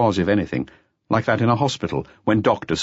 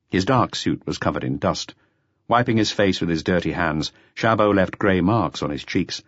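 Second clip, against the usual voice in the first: ordinary delivery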